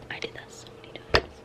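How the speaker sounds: quick embarrassed whisper. Real, not acted. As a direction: whisper it fast under your breath. Embarrassed aside while editing.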